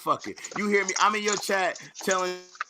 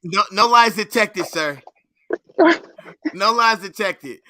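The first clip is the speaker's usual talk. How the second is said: mock verdict; 3 semitones higher, 8 dB louder, slower than usual. Host mock lie detector verdict.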